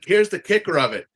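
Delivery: normal talking voice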